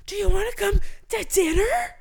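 high, loud strained whisper-yell; tense whisper-shout impression. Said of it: "it's like he's whisper yelling".

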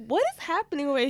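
lightly played bewildered thought; mock bewilderment. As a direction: rattle it off baffled. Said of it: "because I was like".

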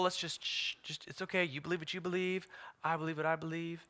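soft, placating murmur; hushed, mock-conciliatory; timid mock hush. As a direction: pretend to back down politely, very softly. Mocks timid, polite believer.

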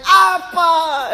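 loud, high imitated cry for father; hurt child's cry. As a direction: wail like a betrayed, drowning child. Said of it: "the hurt and the pain and the broken trust".

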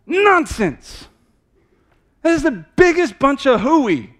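high, loud scoffing shout; loud outburst. Real, not acted. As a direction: blast the excuse away. Preacher rebuts excuse.

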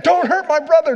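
loud, high shout of "Don't hurt my brother"; the older brother hollers at the defense to stay off his kid brother; big brother yelling. Retold or imitated. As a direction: yell "Don't hurt my brother" like a big brother stepping in to protect him. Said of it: "And he meant it".

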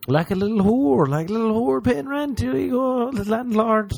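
squeaky cartoonish tenant voice; high silly voice. Mock tenant paying landlord.

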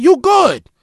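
loud, high promise of protection; reassuring declaration. Retold or imitated. Telling the bullied Brooklyn inmate he's protected, loud and firm. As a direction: tell him firmly he's safe. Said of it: "I said".

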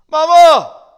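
put-on very high, loud child's yell. Little boy yells back to his mama, very high and loud. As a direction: holler up for Mama. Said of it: "The little boy yelled back".

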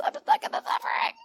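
high whispered whine; mock a believer wallowing in suffering; whiny stuck believer. Preacher mimics stuck believers, high and whiny. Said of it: "They're really like this".

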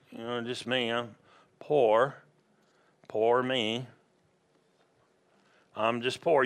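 low, subdued poor-me act; imitating someone feeling sorry for themselves; mock self-pity. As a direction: mope in short, halting bits. Acts out self-pitying poor believer.

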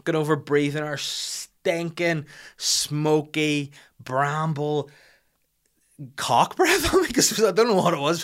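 slow, word-by-word revulsion rising high; outraged, savouring every foul adjective; disgusted rant. Retold or imitated. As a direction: spell out each insult, climbing to a peak. Acted rant about the woman's breath.

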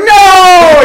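high, loud cry of protest; hammed-up wail of no; desperate yell. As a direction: yell after the rescuer walking away. Retold yelling as neighbor leaves.

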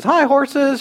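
high, chirpy greeting; sweetly calling out to horses; mock super-cheery wife. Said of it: "yell at horses".

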